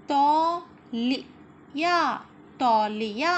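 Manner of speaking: मोर loud, the second slowly drawn out; read-along word. Shown as a demonstration. Teacher reading words aloud for children to repeat, slow and loud. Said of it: "अगला शब्द है हमारा".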